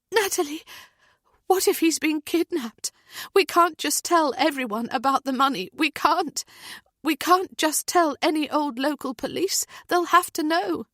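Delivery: high, pressing panicked voice; frantic mother. A narrator's reading. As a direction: plead urgently with a friend. Heather's frantic fears.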